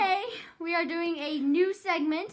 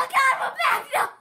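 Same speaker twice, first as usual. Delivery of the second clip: excited shout; 11 semitones higher, 8 dB louder than usual. Real, not acted. Excited return after incident.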